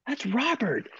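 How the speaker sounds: high, hushed-fan marvel without extra volume; starstruck awe. Retold or imitated. Musician marvels that Robert Lamb calls him, starstruck.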